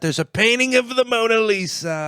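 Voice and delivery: high-pitched mock barker voice; mock showman. Showman boasting to folks of foreskin painting, hammed-up.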